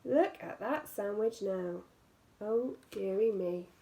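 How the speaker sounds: ordinary delivery, nothing marked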